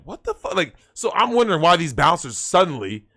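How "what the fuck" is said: high, hushed retold disbelief; softer, high-pitched mock bafflement; shocked confusion. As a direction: replay the baffled moment, pitch jumping up. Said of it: "And I'm like".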